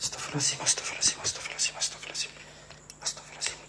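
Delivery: whispered repeated prayer, breathless with fear; frightened whispered prayer. Real, not acted. Alone in the dark haunted room, whispering prayers in fear. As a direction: whisper it over and over to calm yourself.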